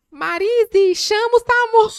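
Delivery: loud, high-pitched yell for help; retold panicked shout. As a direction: shout to someone in the back room to get an ambulance. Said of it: "na hora eu gritei assim".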